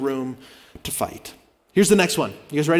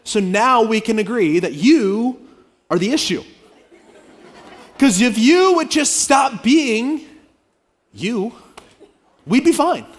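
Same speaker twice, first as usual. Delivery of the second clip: raised, slow, accusing voice; impatient blame; 5 semitones higher, 4 dB louder than usual. Acted. Pastor playing an impatient partner, accusing. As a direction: lay the blame, slow and pointed.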